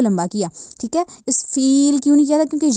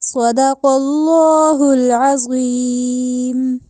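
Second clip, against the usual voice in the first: Quran recitation; 3 semitones higher, much slower than usual. Shown as a demonstration. Teacher reciting Surah Rahman verses in slow melodic chant. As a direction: chant the verses slowly in full tajweed.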